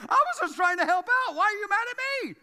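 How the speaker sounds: high, loud protesting wail; over-the-top aggrieved outburst; exasperated shouting. Acted. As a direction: yell it in hammed-up frustration. Acted frustrated Moses pleading with crowd.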